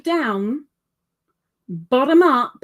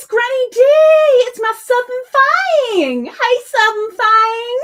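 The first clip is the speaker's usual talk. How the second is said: high, loud, drawn-out Southern holler; big mock-Southern greeting; generic Southern accent; 13 semitones higher, 8 dB louder than usual. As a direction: call out slowly in a put-on Southern twang. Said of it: "doing a generic Southern thingy".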